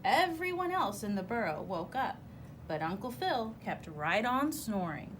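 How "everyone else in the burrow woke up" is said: usual speaking voice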